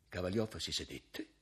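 ordinary delivery

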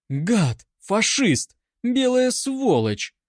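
cries climbing to very high; acted defiant yelling; shouting abuse. A narrator's reading. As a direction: hurl each insult separately, rising. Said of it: "кричала Анка".

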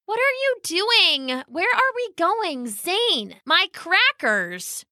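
high-pitched bewildered protest; flustered protest. Acted. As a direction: protest at being dragged away. Dragged sister protests in confusion.

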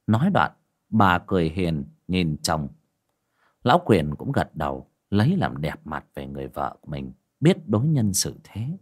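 ordinary talk, nothing marked

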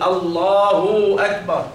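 slow "الله أكبر" as allaahuu akbar; overlong damma. Shown as a demonstration. Teacher showing the error of added waw, long held u. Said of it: "لا تشبع فتصير من بعدها واو".

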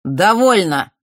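loud echoing command. A narrator's reading. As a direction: stop the fight with one word. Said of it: "громкий, отдавшийся неожиданным эхом, голос". (громкий властный окрик)

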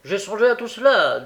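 loud, high eager insistence; energetically. A narrator's reading. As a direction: burst out with energy. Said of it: "avec énergie".

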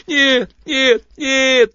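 high, loud yelping whine; whining dog-like creature. A narrator's reading. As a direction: whimper it like a beaten dog. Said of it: "собачий скулёж". (собачий скулёж)

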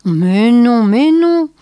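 hoarse husband comforting his wife. A narrator's reading. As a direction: rasp out a reassurance. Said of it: "d'une voix rauque".

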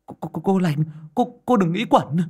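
high, loud stammered pleading; panting. Acted. Boy gripping her coat, panting desperate plea. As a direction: beg her while straining for breath. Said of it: "nó thở hồng hộc".

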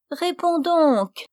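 high, drawn-out wheedling; coaxing old witch. A narrator's reading. Old witch pressing Even for an answer, coaxing. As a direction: coax slowly in a high, cajoling voice. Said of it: "d'un ton câlin".

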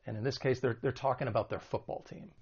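ordinary talk

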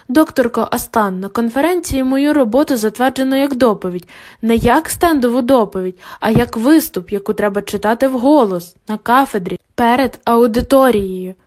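usual speaking voice

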